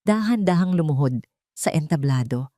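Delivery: plain reading voice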